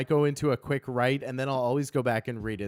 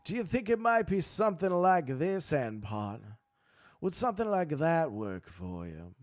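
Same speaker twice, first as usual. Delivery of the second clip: Matthew McConaughey. Shown as a demonstration. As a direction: lean into a Southern lawyer's accent. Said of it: "like a little Matthew McConaughey".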